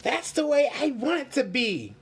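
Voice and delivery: high, loud, longing; eager wish. Acted. Pinocchio wishing to be like Lucia, high and eager. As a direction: say it wanting it badly. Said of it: "thought Pinocchio".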